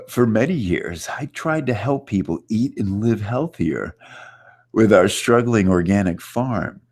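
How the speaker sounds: a playful take on Dean Jackson; lightly hammed-up Dean Jackson read. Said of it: "that was my best Dean Jackson".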